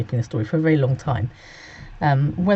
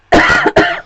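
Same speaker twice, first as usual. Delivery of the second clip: sharp real cough; a sudden cough mid-talk; coughing fit from a cold; 9 dB louder than usual. Real, not acted. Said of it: "Oh dear, excuse me".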